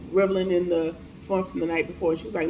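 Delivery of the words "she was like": ordinary talk, nothing marked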